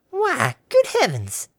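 high, slow astonished exclamation; surprised old granny. A narrator's reading. Granny noticing Harmony isn't sweating, slow and amazed. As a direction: gasp it out slowly in wonder.

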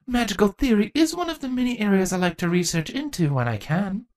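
put-on eager, nerdy young girl voice; gushing about history; bookish young changeling. Chrysalis's voice.

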